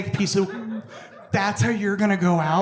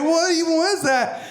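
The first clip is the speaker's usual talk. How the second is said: dazed; 8 semitones higher than usual. Retold or imitated. Dazed after the fall.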